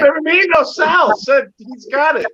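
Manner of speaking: hammed-up mimicry of a clueless drunk; mocking impression.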